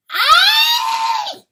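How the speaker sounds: long, very high piercing shriek; loud shriek. A narrator's reading. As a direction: shriek at full force in torment. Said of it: "shrieks Chanticleer".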